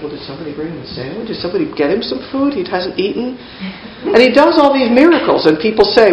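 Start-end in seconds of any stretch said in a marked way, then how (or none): 0.0-3.4 quick, hushed aside among puzzled followers; acted baffled murmur, much softer; puzzled muttering. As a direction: mutter it fast and very softly. Puzzled disciples whispering among themselves.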